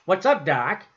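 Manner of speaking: lightly voiced cartoon rabbit catchphrase; Bugs Bunny impression. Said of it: "as a bunny rabbit, say".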